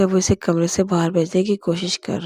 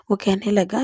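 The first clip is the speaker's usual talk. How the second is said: ordinary delivery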